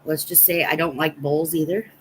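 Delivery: ordinary talk, nothing marked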